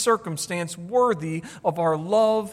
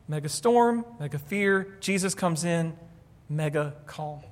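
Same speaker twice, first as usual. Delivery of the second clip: each phrase softer and lower until mega calm is hushed; building down to calm; 4 semitones lower, 5 dB quieter than usual. Shown as a demonstration. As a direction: let mega calm fall quiet. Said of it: "contrast storm and calm".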